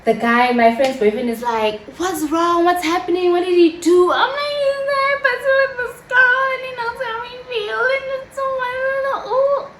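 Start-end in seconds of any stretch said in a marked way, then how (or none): 2.0-4.1 raised-pitch worried questions; alarmed concern. Acted. Boyfriend's alarmed questions, acted. 4.1-9.7 hammed-up high-pitched sobbing complaint; hysterical crying. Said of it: "I am hysterical".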